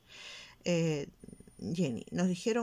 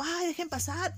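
annoyed driver mock; 10 semitones higher than usual. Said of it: "Paran el tránsito".

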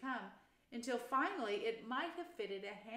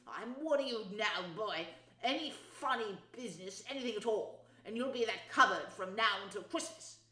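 pointed, forceful uncle's warning; stern threat; 2 semitones higher, 6 dB louder than usual. A narrator's reading. Uncle threatening his nephew with the cupboard, stern. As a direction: lay down the law with menace.

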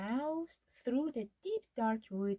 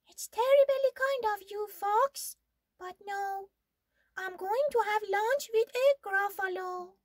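mouse voice, much higher, louder and quick; breezy polite brush-off, fast; bright little mouse; 10 semitones higher, 6 dB louder than usual. Acted.

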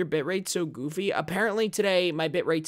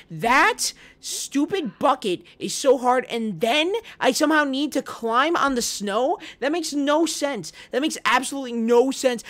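louder, higher, exasperated rant; frustrated rant; 7 semitones higher, 6 dB louder than usual. Real, not acted. Streamer ranting to chat about the bucket, frustrated. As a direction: vent at the screen. Ranting at hard game section.

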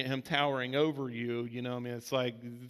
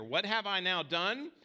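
slow preached scripture line; 4 semitones higher, slower than usual. Acted. David's famous line, slowed.

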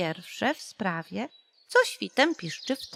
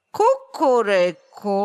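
loud, slow rooster crow; angry crow; 7 dB louder, slower than usual. A narrator's reading. The rooster on the stick crows angrily at the sparrow. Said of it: "kogut na patyku zapiał gniewnie".

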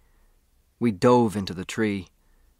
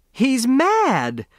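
very high, louder shriek of panic; panicked shriek; 5 dB louder than usual. A narrator's reading. Grace shrieks at the charging weasel, high and panicked. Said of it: "That was the woman".

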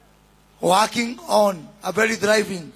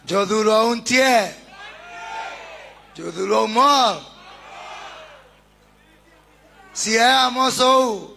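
calling out to the crowd; 4 semitones higher than usual. Real, not acted. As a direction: hail each group in the crowd. Crowd greetings called out at rally.

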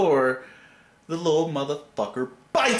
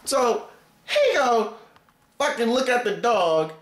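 ordinary delivery

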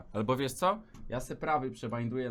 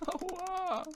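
sharp high yelp of pain; 4 dB quieter than usual. Real, not acted. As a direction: yelp like your finger hurts. Pain cry from clicking. (krzyk bólu)

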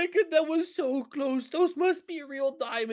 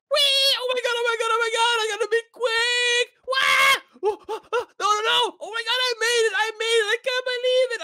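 high, loud thrilled cries; excited yelling; 9 semitones higher, 4 dB louder than usual. Real, not acted. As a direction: shout in thrilled escape. Boat escape.

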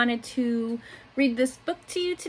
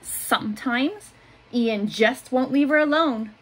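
put-on gentle picture-book reading for little kids; reading to children; 4 dB louder than usual. A narrator's reading. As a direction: read it like story time in class. Teacher reads picture book aloud.

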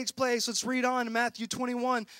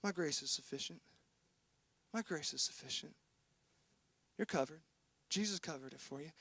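much softer, lower comforting voice; gentle Holy Spirit; 7 semitones lower, 15 dB quieter, faster than usual. Retold or imitated. The Holy Spirit comforting a guilty believer, soft and tender. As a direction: soothe him quietly, like a tender reassurance. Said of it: "the Holy Spirit comes and speaks and goes".

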